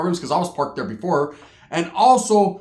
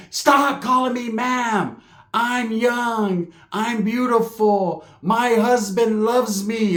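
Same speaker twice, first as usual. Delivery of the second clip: offended woman; 4 semitones higher, much slower than usual. Retold or imitated.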